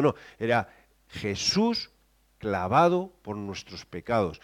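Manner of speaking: ordinary talk, nothing marked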